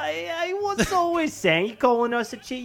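high, squawking British protest; cartoon British outrage; mock indignation. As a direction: act affronted in a hammed-up British accent. Mock-offended British host scolds guest.

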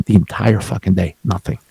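normal talking voice

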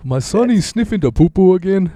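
lightly done Apu-style voice; a father complains about his son, in a mock accent. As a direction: play a worried father in a put-on accent. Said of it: "the Apu voice was coming".

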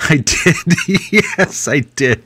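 high, giggling admission; laughing. Real, not acted. Laughs at being teased.

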